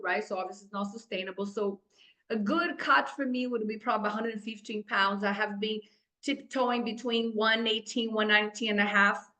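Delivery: ordinary talk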